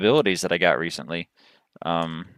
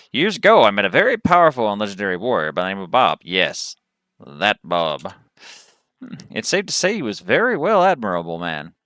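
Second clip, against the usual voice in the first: lively Southern cowboy voice, pitched up; cowboy accent; 2 semitones higher, 4 dB louder than usual. Acted. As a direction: play a cowboy storyteller with a Texas accent. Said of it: "that's the accent I chose".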